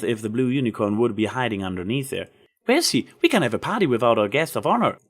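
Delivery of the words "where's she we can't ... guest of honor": high, quick, puzzled pony; Pinkie Pie voice. Acted. Pinkie searches for the guest of honor, high and quick. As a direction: hunt for the missing guest.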